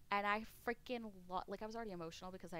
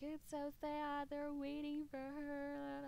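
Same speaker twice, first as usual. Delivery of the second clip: slow, higher, weepy lament; mock crying; 7 semitones higher, much slower than usual. Streamer quoting herself sobbing at the dogs, slow teary. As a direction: drag it out like you're about to cry. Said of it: "I'm losing it".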